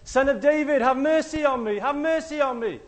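high, loud pleading cry; desperate shout. Retold or imitated. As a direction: yell it over the crowd's hushing. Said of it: "in response, he says".

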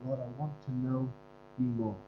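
hushed prayerful plea; soft prayer. Acted. Preacher praying quietly before the congregation. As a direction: speak to the Lord softly, intimately. Prays softly mid-sermon.

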